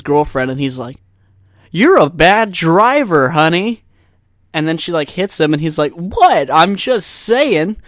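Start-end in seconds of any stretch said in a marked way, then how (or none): 1.7-3.8 high, loud, overblown sing-song delivery; slow, overdone ad acting; mock bad commercial acting. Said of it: "I can't even act that bad on purpose". 5.9-7.8 high, loud, drawn-out protest; hammy, exaggerated innocence; mock overacting. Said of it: "They're just so over-, overreactive".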